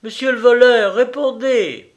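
loud, high-pitched call; shouted playful demand; teasing hail. A narrator's reading. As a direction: hail a hidden stranger in mock summons. Teasingly hails the supposed thief.

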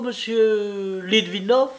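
slow, high, questioning “Litvinov”; hesitating over name. A narrator's reading. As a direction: draw the name out as if unsure of it. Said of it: "prononçait toujours avec hésitation le nom". (hésitation feinte sur le nom, lente et aiguë)

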